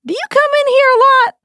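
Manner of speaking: comic high-pitched restart of the line; squeaky redo. Acted. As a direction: try the line again as a silly squeak. Scene restarts after the bell.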